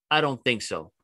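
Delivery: ordinary talk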